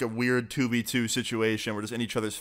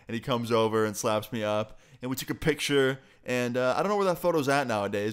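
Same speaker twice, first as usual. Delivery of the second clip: normal talking voice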